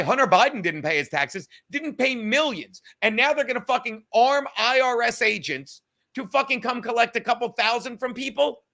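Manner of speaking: normal talking voice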